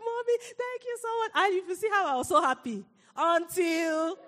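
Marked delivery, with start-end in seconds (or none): 0.0-1.3 put-on excited little girl. Daughter thanks mommy, excited.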